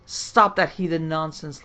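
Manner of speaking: loud, commanding near-roar. Acted. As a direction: bark the order at him, full force. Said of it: "Lamarck almost roared".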